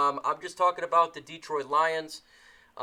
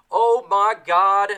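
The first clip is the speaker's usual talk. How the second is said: surprised; 5 semitones higher, 12 dB louder, slower than usual. Real, not acted. Surprised by a stream donation.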